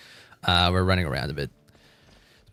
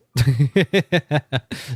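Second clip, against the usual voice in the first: short amused laugh; playful chuckle; light laugh; 4 semitones higher, 3 dB louder than usual. Real, not acted. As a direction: laugh off the tease. Teasing with chat.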